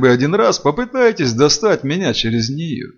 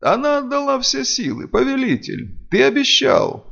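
high, urgent plea from a servant; pleading vampire; 11 semitones higher than usual. A narrator's reading. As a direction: beg your master to keep his word.

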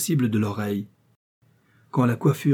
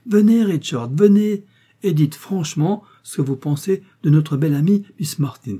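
much higher and louder, calling to the next room; 6 semitones higher, 5 dB louder than usual. Acted. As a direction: call him in through the door. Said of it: "Marguerite ouvrit la porte ... et s'écria".

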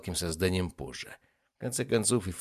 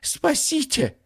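very high, loud wail; howl for help; 5 dB louder, much slower than usual. Acted. Trapped ifrit calls to be saved, howling. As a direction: howl it to the heavens. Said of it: "взвыл он".

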